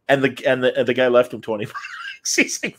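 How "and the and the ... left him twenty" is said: ordinary talk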